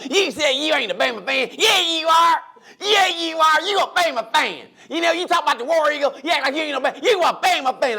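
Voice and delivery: high, heated caller caricature; Southern radio caller. Retold or imitated. Radio caller accusing someone over Bama fandom, heated caricature. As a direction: argue in a high-pitched comic Southern voice. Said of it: "somebody making fun of the South".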